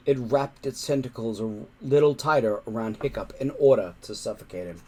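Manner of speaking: ordinary delivery, nothing marked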